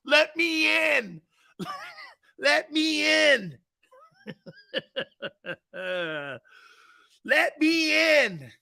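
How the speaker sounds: high, slow eerie moan; spooky mock spirit; ghostly voice. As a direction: wail it like a ghost. Imitating Poltergeist voice, repeated.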